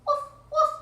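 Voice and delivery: high, loud little dog bark; puppy woof. Acted. Reader voicing Biscuit the puppy for children, high woofs. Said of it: "woof like Biscuit".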